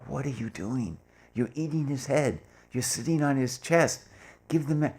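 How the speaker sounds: imitating Erich scolding the helpers; retold yelling. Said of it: "he starts yelling at us".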